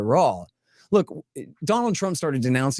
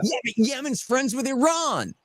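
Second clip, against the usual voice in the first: much higher, whiny mocking retort; mock maga reply; 10 semitones higher than usual. Mocking a maga guy's excuse to his co-host, high and whiny. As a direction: squeak it out like a dismissive fan. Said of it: "And they'll go".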